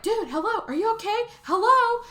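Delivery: high, rising mock call; calling out in concern. Outsider calls to check if someone is okay, high concerned call.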